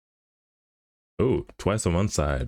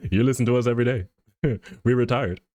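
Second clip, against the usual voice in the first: higher voice breaking into chuckles; amused band boast; 6 semitones higher, faster than usual. Pretend. Animator plays retired elevator band for a joke, laughing. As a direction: play the band while cracking yourself up.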